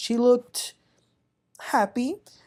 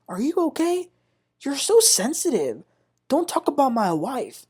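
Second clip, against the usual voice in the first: higher, sing-song imitation of a taunt; mocking; 7 semitones higher than usual. Damon taunting Greg, imitated mockingly. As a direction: mimic his condescending tone, lightly. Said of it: "in a mocking way".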